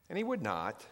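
normal talking voice

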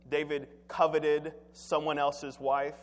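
ordinary talk

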